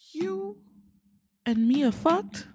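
high, loud, slow mock-shocked question; scandalized disbelief, high and drawn out; shocked exclamation. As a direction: gasp it out, stretching the last word. Jordan realizes the affair.

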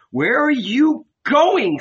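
high, drawn-out demand; indignant challenge. A narrator's reading. Martha grabbing Peter at the door, indignant high challenge. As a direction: challenge him in a high, slow voice.

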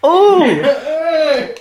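high, delighted "oh" with a laugh; delighted reaction. Real, not acted. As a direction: squeal with impressed surprise. Delighted reaction to her accented line.

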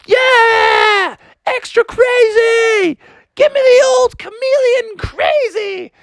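very high, strained excited shouts; wild cheering. Acted. Froggy and Chloe cheer on the driver, wild shouting. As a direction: scream for it like thrilled kids.

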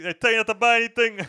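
escalating mock rant, laughing. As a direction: push the scolding up a notch while cracking up. Mock De Niro scolding, louder take.

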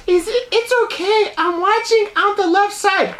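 very high, put-on girly voice, slowing at the end; exaggerated high-pitched anime girlfriend. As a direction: pitch it way up like a cartoon girl. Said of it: "that's a stereotypical girl voice".

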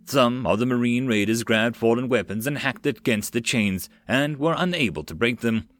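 usual speaking voice

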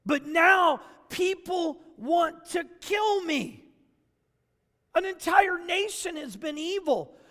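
high, loud pleading self-defence; defensive Elijah. Pretend. Elijah deflecting to God, high and pleading. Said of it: "here's what Elijah was saying".

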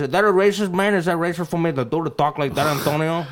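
put-on Mexican-accented English; mock Mexican accent. Shown as a demonstration. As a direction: ask it in a hammed-up Mexican accent. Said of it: "started talking like a Mexican".

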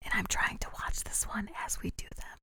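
hushed whisper. Real, not acted. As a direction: drop to a whisper. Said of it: "Why are we whispering?".

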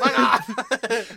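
loud laughing fuck off; mock biker shout. Retelling the biker girl flipping everyone off, loud laughing shout.

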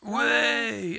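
high, loud whoop of delight; excited whoop. Real, not acted. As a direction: cheer the news. Reacting to the birthday news.

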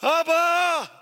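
high, loud cry of Abba; a child's frantic shout for his dad; boy's desperate shout. Retold or imitated. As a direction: cry out for your father in panic. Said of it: "shouting at the top of his lungs".

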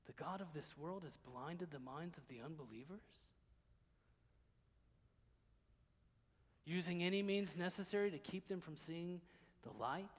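low, soft, sober sermon voice; hushed preaching. Real, not acted. Preacher pondering spiritual blindness with the congregation, hushed preaching. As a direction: draw the congregation in quietly.

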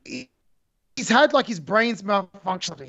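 normal talking voice

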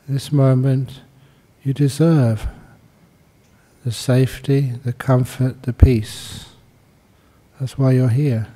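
calm meditation guidance with long pauses; guided meditation. Acted. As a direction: guide them unhurriedly into stillness, pausing between phrases.

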